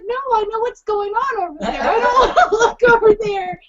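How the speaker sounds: high retold refusal, breaking into laughter; laughing protest. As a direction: act out frantic refusal while laughing. Retelling her own frantic refusal.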